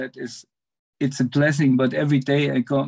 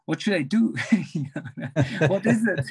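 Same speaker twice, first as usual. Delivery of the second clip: laughing plea; 6 semitones higher than usual. Retold or imitated. Acts out his laughing, pleading prayer.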